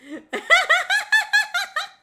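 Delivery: high, loud cackling giggle; laugh. Real, not acted. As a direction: crack up in a high, long laugh. Laughs at own act.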